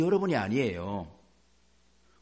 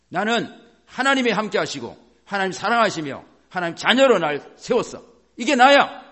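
loud, raised voice building to a peak; forceful proclamation; 5 semitones higher, 7 dB louder than usual. Shown as a demonstration. Discouraged believer claiming to be God's child, forceful proclamation. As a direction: declare it boldly, hammering the last phrase.

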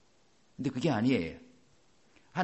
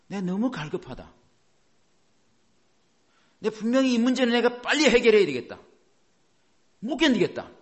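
louder and higher, urgent cry; desperate longing; 8 semitones higher, 7 dB louder than usual. Retold or imitated. A believer crying out that they can't endure it, urgent and loud. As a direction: blurt it out like you can't bear it.

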